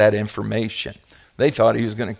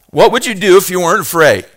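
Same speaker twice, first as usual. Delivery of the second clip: louder, fast proclaimed sign quote; 6 semitones higher, 6 dB louder, faster than usual. Shown as a demonstration. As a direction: read the sign out boldly. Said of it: "And it asks this question".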